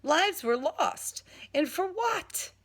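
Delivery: rising intensity. Acted. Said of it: "my voice rising in intensity".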